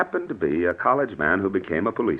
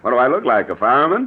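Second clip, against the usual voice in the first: louder, higher sarcastic comeback; indignant retort; 5 semitones higher, 5 dB louder than usual. Acted. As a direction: scoff at the question.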